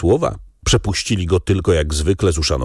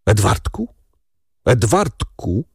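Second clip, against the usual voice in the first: strained, halting call with pauses; understated choked questioning. A narrator's reading. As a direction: force the name out through a tight throat. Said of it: "wykrztusił".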